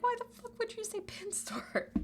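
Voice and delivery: very high squealed outrage; squeaky mock outrage. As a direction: screech it like the meme. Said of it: "It's like".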